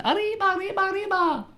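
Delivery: high-pitched squeaky Mexican cheer; mock Mexican accent. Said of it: "They were Mexican".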